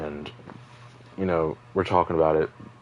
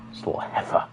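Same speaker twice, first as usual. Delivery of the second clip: mocking heifer; 3 dB quieter than usual. Mock insult of friend.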